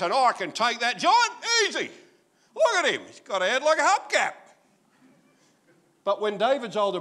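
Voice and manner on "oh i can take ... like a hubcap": loud, high swaggering brag; cocky boast. Retold or imitated. Preacher voicing David boasting he can beat Goliath, loud and high. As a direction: brag about the giant loudly. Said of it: "So David says".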